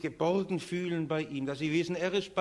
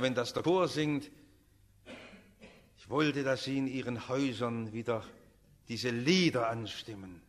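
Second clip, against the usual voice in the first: normal talking voice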